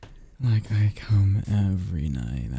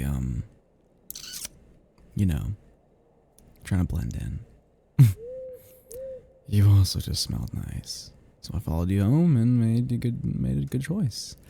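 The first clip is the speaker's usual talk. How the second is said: ordinary talk, nothing marked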